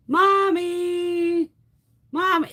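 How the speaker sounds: high, loud, sung-out wail; lost child calling. Acted. Tiny child alone in a store aisle, calling out for mother. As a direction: stretch the call out like a stray little kid.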